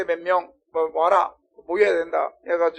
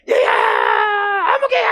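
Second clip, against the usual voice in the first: put-on screamed, high wail for a lost child; long, loud bereaved scream; wailing mother; 12 dB louder, much slower than usual. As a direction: cry out the name in full-throated grief. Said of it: "하면서 울면서 오는데".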